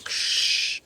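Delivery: unvoiced spraying hiss; fire extinguisher hiss. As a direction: blast the extinguisher with a whispered ksh.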